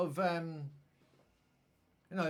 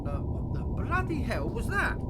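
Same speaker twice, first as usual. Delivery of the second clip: startled; 6 semitones higher, 5 dB louder than usual. Real, not acted. Startled at footage.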